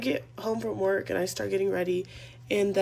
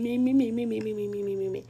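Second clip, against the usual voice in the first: rattled-off mimicking nonsense chatter; mock his nagging with babbled syllables; dismissive mock yammering; mocking babble; faster than usual. Mocks his questions as nagging.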